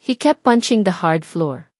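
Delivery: plain reading voice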